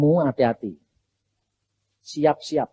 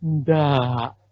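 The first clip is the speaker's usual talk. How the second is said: normal talking voice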